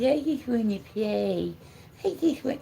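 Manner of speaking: pleading puppy. Acted. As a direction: voice the dog begging to play. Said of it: "He says".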